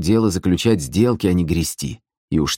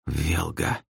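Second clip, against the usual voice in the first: hushed, much softer utterance; barely audible; 8 dB quieter than usual. A narrator's reading. Brother realising who the client is, barely audible. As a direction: say it almost under your breath. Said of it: "проговорил едва слышно Белый".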